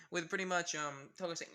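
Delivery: usual speaking voice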